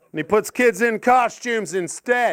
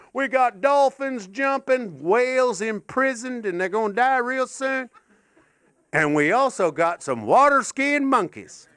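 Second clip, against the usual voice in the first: seventies Florida advert pitchman; 5 semitones higher than usual. Acted. Pitchman advertising SeaWorld in seventies Florida, high hammed-up pitch. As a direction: sell it like an old Florida TV commercial. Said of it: "I'm doing an advert for SeaWorld".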